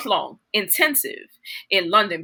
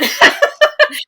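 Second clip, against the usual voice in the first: loud high laugh; high burst of laughter; 11 dB louder than usual. Real, not acted. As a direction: crack up.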